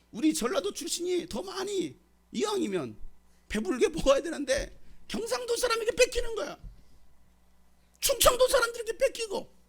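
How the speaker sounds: high-pitched grumbling voice of complaining members; mock complainer. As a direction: play the petty church members griping over food. Mocking complaining church members. (투덜대는 교인 흉내, 높은 목소리로)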